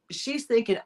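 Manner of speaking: normal talking voice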